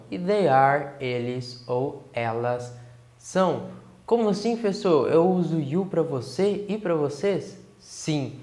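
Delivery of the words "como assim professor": high, puzzled student asking teacher; curious student. Pretend. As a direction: voice a confused pupil. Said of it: "pergunta do aluno imaginário". (aluno confuso perguntando ao professor, voz alta)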